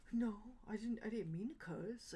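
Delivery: breathy, guilty whisper; teen scolded by her mom whispers a timid apology; sheepish whisper. Retold or imitated. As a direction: whisper the excuse like a caught kid.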